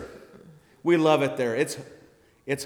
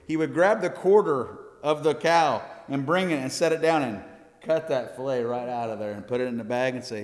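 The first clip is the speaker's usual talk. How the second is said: normal talking voice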